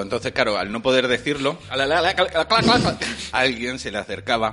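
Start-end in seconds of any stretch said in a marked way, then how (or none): 1.7-3.0 high, loud stammer climbing in pitch; exaggerated mock stuttering; imitated stutter. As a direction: get stuck repeating the syllable, rising higher and louder. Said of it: "era tartamudo".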